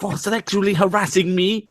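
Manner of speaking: high-pitched mock outrage; Zarna Joshi. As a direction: imitate an indignant woman way up high. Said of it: "you can just hear Zarna Joshi shouting".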